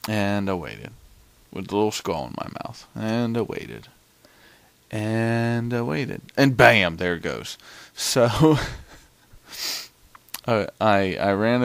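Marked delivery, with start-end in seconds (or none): 4.9-6.2 very slow, stretched-out words; drawn-out waiting. Real, not acted. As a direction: drag it out to show the wait. Dragging out a long wait.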